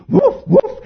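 a high yappy woof woof; high mock dog bark. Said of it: "I'd make him speak".

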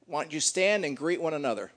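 ordinary talk, nothing marked

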